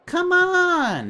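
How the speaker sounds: a tiny creature hopping with impatience; impatient grumble. Acted. Jan hurries the traveller along, high and impatient. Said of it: "it grumbles impatiently".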